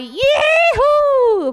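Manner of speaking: high, loud "woo-hoo"; celebratory whoop. Pretend. Mock ad ends with a whoop. (grito agudo de comemoração)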